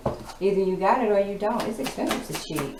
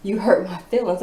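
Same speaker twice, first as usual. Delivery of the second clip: normal talking voice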